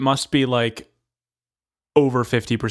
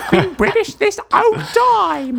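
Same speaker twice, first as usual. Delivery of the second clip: high, slow, hammed-up British accent; loud, drawn-out mock-British claim; Meryl Streep; 4 dB louder than usual. Pretending to the Queen to be British, hammed-up accent. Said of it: "pretend to be British".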